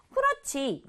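normal talking voice

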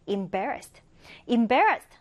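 "embarrassed" as im-BAR-rassed; second-syllable stress, im onset. Shown as a demonstration. Teacher modelling careful pronunciation for learners, "embarrassed" as im-BAR-rassed. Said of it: "두 번째 음절에 강세를 두고".

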